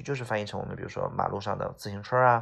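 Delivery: ordinary talk, nothing marked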